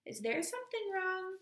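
very high, concerned query; concerned question. A narrator's reading. Miss Whitlaw asking after a pause on the line, concerned. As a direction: lift the voice right up, probing gently.

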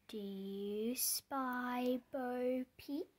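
ordinary delivery, nothing marked

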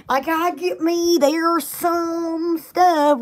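slow, drawn-out Southern twang; Southern accent. Retold or imitated. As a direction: imitate a Southern drawl. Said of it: "they would say".

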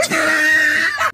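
loud, high burst of laughter; excited laugh. Real, not acted. Young man bragging about moving out, loud giddy laugh.

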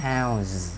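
"how's" ending in a long buzzed z; isolated "how's" with a voiced zzz tail. Shown as a demonstration. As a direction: hold the z on "how's". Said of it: "thành z".

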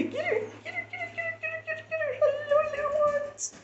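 squeaky high puppy voice, cooing. Real, not acted. Owner cooing at the puppies in high baby talk. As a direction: coo at the puppies in a tiny high voice.

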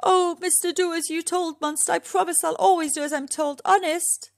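high, anxious begging; high-pitched, earnest promise; frightened pleading. A narrator's reading. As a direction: plead with a monster to spare you. Junior pleads with the frightening monster.

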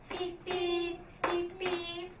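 put-on sing-song beeps for the kids to copy; honk imitation. Shown as a demonstration. Teacher leads the class saying beep beep. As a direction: beep it like a car horn. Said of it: "let's say".

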